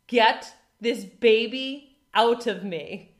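slow, loud, every word pressed; intense demand. Retold or imitated. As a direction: stare into her soul and demand it. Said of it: "I look her, like, in the soul".

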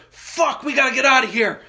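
high, loud panicked father; panicked getaway dad. Retold or imitated. Dad fleeing the field, panicked getaway urgency. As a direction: bark it like a getaway driver. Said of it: "My dad goes".

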